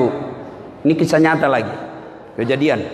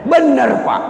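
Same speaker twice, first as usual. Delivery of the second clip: ordinary talk, nothing marked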